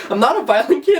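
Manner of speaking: high, loud and laughing; laughing at her own irony; mock innocent protest. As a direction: insist you're harmless, knife in hand. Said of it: "and I was like".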